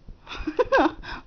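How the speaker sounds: an amused airy laugh, tender; warm mom laugh. Real, not acted. Dog has the hiccups.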